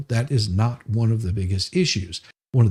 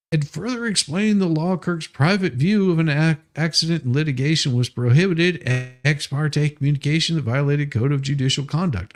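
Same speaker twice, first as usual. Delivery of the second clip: reading legal text aloud in a raised, pompous tone; mock formal brief reading; 4 semitones higher than usual. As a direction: declaim it like a self-important lawyer.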